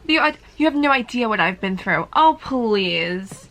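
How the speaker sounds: loud scornful retort; scoffing outburst. Real, not acted. Answering the book's villain with scorn. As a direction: fire it back in disbelief.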